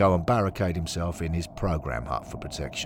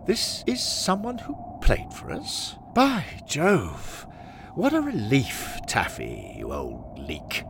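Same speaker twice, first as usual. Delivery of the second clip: high exclamations settling to usual pitch; pompous club father, relieved; 6 semitones higher, slower than usual. Acted. Henry learns the ghost played for them, relieved club father. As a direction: start astonished, then sink into relief.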